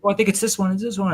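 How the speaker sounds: put-on high excited child voice; squeaky little kid guessing. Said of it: "And then they're like".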